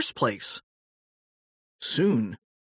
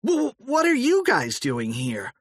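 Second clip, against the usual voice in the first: stammering surprise; 10 semitones higher than usual. A narrator's reading. Stunned old George questions boys.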